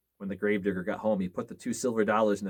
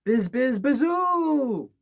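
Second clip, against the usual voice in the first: high, loud wind howl rising on bazoo; eerie wind whoosh; 14 semitones higher, 6 dB louder, much slower than usual. A narrator's reading. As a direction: moan it like wind in the cracks. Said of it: "it went".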